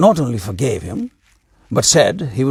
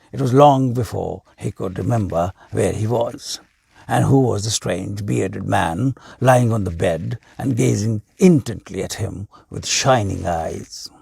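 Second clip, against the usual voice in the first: plain reading voice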